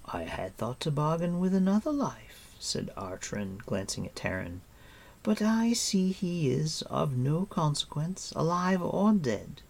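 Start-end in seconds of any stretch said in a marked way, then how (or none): 0.1-2.5 cool queen. Acted. Queen bargains. 5.2-9.6 high, lilting regal voice; cool queenly disdain; dismissive queen. Acted. Queen writing off the other captive, dismissive.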